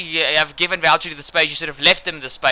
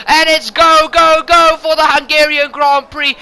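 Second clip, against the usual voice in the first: big excited shout, much higher and louder; race start call; 9 semitones higher, 6 dB louder than usual. Real, not acted. Commentator calls the race start to viewers, excited shout. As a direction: yell the start like the lights just went out.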